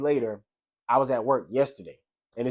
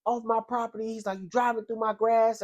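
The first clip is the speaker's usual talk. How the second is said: mock angry yelling; 8 semitones higher, 4 dB quieter than usual. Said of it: "But he literally was cussing".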